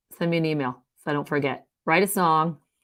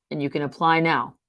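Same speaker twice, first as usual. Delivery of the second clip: ordinary talk, nothing marked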